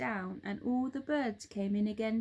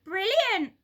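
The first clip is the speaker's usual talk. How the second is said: much higher, loud gleeful shriek; shrill delighted cry; 11 semitones higher, 9 dB louder than usual. A narrator's reading. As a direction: cackle with delight, grinning. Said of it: "cried Missus Twit".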